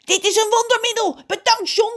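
very high, loud, elated squawk; screaming crow. A narrator's reading. Crow thanks John for the remedy, screaming. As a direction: shriek it out like an excited crow. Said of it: "schreeuwt hij".

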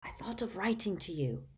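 put-on hushed old man's voice; whispered. A narrator's reading. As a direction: confide it softly. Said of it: "he whispered".

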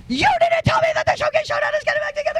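very high, fast accusing wife voice; shrill it out fast like an upset wife; high, fast mock wife accusing husband. Wife's accusing text voiced.

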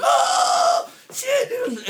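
high laughing-crying panic yelp; panicked shriek. Retold or imitated. Injured man's reaction, retold.

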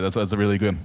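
normal talking voice

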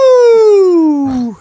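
long, high, loud wrestler's whoop; Ric Flair. Acted. Podcaster capping a wrestler impression, long shrieked woo. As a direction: hold a piercing showman's woo to the end of the breath. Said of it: "That was nineteen eighties Ric Flair".

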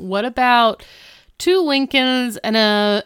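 mock bargaining, rising loud; hesitant bargaining. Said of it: "he's just like".

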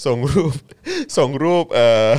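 high, loud, breaking into laughter; can't cool down, voice lifted and laughing; real giggly, overcome. Real, not acted. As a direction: try to explain through real laughter, touched and delighted. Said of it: "คูลดาวน์ก่อน".

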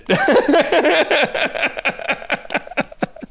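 high burst of laughter at himself; high embarrassed laugh. Real, not acted. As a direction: laugh at your own joke. Laughing after admitting he wrote it.

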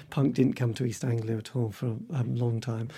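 usual speaking voice

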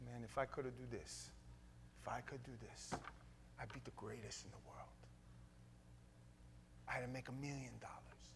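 put-on whispered Brazilian-Italian accent; hushed fighter with a Brazilian-Italian accent. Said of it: "between my Brazilian and my Italian accent".

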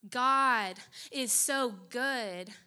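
very slow, weighty declaration; slowed emphasis. Real, not acted. Preacher proclaiming God's goodness, slow and weighty. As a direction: lean on each word.

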